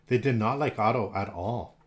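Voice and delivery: ordinary delivery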